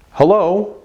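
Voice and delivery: "Hello" as a high, simple greeting; statement greeting. Shown as a demonstration. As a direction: say "Hello" as a statement, not a question. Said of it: "now instead of saying".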